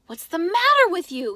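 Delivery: loud, high protest; indignant kid. A narrator's reading. Ace chases Nicky out, loud indignant protest. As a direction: call after him, put out.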